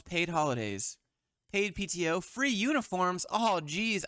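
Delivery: normal talking voice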